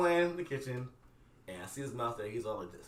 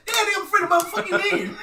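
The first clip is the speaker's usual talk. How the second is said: laughing; 11 semitones higher, 6 dB louder than usual. Retold or imitated. Exasperated retold answer.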